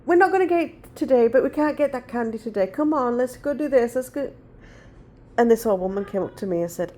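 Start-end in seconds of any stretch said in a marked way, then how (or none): 0.1-4.3 much higher, sing-song coaxing; coaxing toddler. Retold or imitated. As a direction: sweet-talk a tantrum child out of the shop. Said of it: "And I kept saying".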